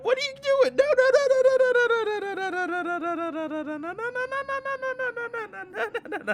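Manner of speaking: squeaky frantic string of no; high desperate no; panicked high pleading. Real, not acted. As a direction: beg him not to go. Begging character not to leave.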